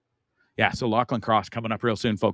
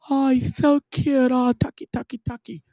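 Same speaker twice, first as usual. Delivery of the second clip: put-on gushing girl; 12 semitones higher than usual. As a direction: coo at the dog like a smitten girl. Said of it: "You'd think".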